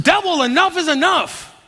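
high-pitched retold cry of exasperation; fed-up outburst. Pastor venting to his executive pastor, exasperated. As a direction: push back at the devil, pitch jumping up.

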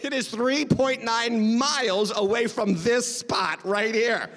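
slow, deliberate reveal; emphatic punchline. Real, not acted. Preacher revealing how near the town is, emphatic punchline. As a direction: spell out the distance slowly and pointedly.

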